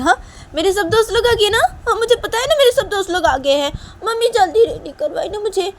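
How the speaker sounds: put-on high, loud, eager kid; impatient child voice. Voicing Nisha's excited little daughter.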